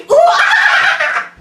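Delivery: very high loud monkey whoop; high loud laughing monkey call; monkey cry. Shown as a demonstration. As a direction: leap and shriek like a startled monkey. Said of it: "show me your monkey noises".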